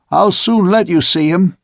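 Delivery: angry. A narrator's reading. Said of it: "now thoroughly angry".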